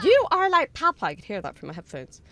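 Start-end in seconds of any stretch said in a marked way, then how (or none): 0.0-1.0 high, loud mimicking squeal; mimicking an alert. Streamer copies the sound just played, high loud mimicry. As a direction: echo it back loud and high-pitched.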